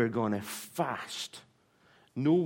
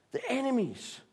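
enemies pitched much higher as a pointed echo; emphatic repeat; 12 semitones higher than usual. Real, not acted. Preacher stressing enemies to the congregation, pitched high. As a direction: land hard on enemies.

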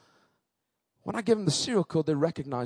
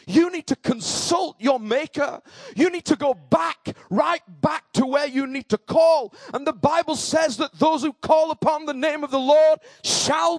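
fervent, higher-pitched gospel proclamation; excited preaching; 7 semitones higher, 4 dB louder, slower than usual. Real, not acted. Preacher urging the congregation to call on the Lord, excitedly. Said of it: "Sorry, I'm getting a little excited".

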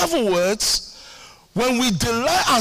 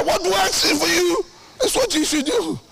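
ordinary talk, nothing marked

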